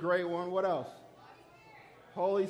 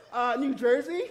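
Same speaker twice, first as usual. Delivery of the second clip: loud, high answer with a questioning lift; confident; 9 semitones higher, 12 dB louder than usual. Retold or imitated. Pupil giving the teacher the wrong answer, loud and confident. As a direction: answer up loudly, sure of yourself. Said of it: "She was so confident".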